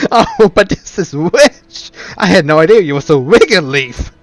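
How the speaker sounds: gloating ridicule. Acted. Villain gloating loudly.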